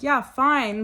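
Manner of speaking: irritated retort. Retold or imitated. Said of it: "And I'm like".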